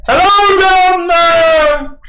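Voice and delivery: very high, slow, far-carrying shout; booming yell. Acted. Caster yelling down with a magically boomed voice, high drawn-out yell. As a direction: boom it out across a great distance. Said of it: "I just yell out".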